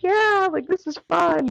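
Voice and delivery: high-pitched cheery yell-along; mock enthusiasm. Runner to the photographer in the pouring rain, mock enthusiasm.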